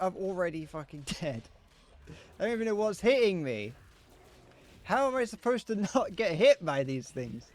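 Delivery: real gamer frustration, almost yelling; frustrated outburst. Real, not acted.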